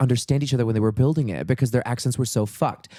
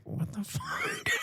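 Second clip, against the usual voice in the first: shocked parents; 11 semitones higher, 12 dB quieter, much slower than usual. Retold or imitated. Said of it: "My mom and dad were like".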